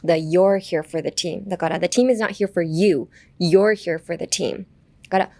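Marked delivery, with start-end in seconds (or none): 0.1-1.5 slow, with "you're" stretched and emphasized; stress on "you're". Shown as a demonstration. Teacher showing where the emphasis goes, stressing "you're". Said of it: "emphasis on the your". 1.8-4.7 slow, leaning on "you" and then "You're"; stress on "you" and "You're". Shown as a demonstration. Teacher demonstrates emphasis for clearer meaning, stressing "you" and "You're". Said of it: "youとyourを強調した方がもっと伝わりやすい".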